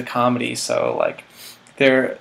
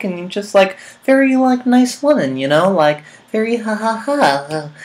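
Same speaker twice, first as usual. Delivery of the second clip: much higher, louder chirpy lady voice; mimicking a bubbly woman; 8 semitones higher, 5 dB louder than usual. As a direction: bubble like a cheery casting lady. Describing the casting director.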